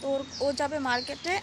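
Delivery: ordinary talk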